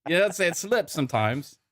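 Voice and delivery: high-pitched put-on accent drifting between British and Australian; playful slipping British-Australian voice; British-Australian mixed accent. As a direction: defend yourself in a wobbly mock British-Australian accent. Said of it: "slipped into Australian and British accents".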